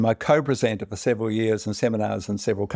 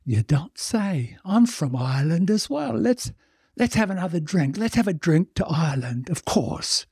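tipsy Irish barfly; 7 semitones higher than usual. Acted. Drunk Irishman toasting his fellow patron, much higher and slurry. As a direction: play a merry drunk Irishman toasting Ireland.

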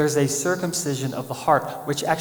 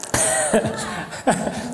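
embarrassed unvoiced chuckle; 6 semitones higher than usual. Real, not acted. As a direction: laugh off your own doubt. Laughs at own doubt.

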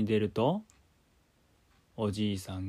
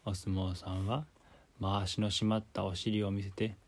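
ordinary delivery

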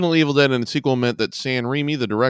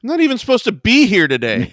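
higher, louder complaining line; exasperated quote; 9 semitones higher, 7 dB louder than usual. Acted. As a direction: whine it out fed up. Quoting Clerks line.

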